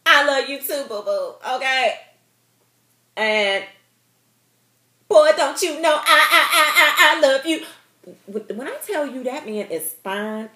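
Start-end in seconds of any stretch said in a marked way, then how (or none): 0.0-7.6 loud, high, swooning I love you; gushing love reply. Pretend. Woman swooning back at a boyfriend's elevator confession, loud and giddy. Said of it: "I would have been like".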